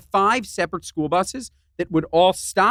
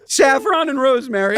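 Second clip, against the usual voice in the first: laughing, much higher; 8 semitones higher, 4 dB louder than usual. Real, not acted. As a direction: squeeze words out through laughter. Laughing through the list.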